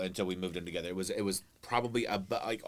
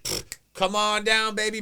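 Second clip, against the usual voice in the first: mock welcome; 9 semitones higher, 5 dB louder, slower than usual.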